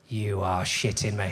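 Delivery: low, slow mock disbelief; slow, deep-pitched acted dismay; appalled disbelief. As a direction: drop low and space the words out. Viewer's disbelief at the telly.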